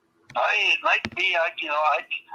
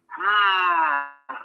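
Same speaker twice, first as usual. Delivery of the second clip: ordinary delivery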